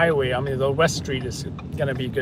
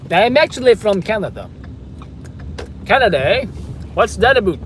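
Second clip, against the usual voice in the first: higher, louder put-on Canadian accent; mock Canadian accent; 4 semitones higher, 3 dB louder than usual. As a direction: play up a cartoon Canadian accent. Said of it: "switched from being French to Canadian".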